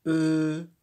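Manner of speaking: put-on loud, carefully held "e." for a child learner; teacher modelling the sound "e." loudly; sounding out a syllable. Shown as a demonstration. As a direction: sound out "e." slowly and clearly. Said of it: "Elle fait".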